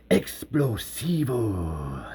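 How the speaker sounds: slow Spanish "Explosivos", rolled and drawn out; Spanish word. Shown as a demonstration. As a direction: read the label with Spanish flair. Spanish crate label read.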